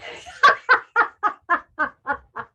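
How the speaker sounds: loud, high burst of ha-ha laughter on the out-breath. Shown as a demonstration. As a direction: push the laugh out after a breath in. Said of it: "laugh out".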